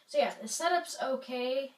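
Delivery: ordinary delivery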